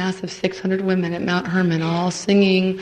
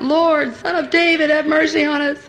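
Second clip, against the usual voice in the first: raised, higher cry for mercy; shouting out; 8 semitones higher than usual. Retold or imitated. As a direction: call out over the crowd. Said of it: "they shouted out".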